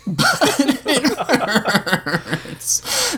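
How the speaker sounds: very slow, high sobbing wail; drawn-out, high crybaby sob. A narrator's reading. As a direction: wail it out through tears. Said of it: "Josh sobbed".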